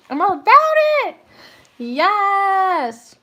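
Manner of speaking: high, loud, delighted squeal; squealing excitement. Real, not acted. Reader squealing with delight over a reading. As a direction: squeal the words with joy.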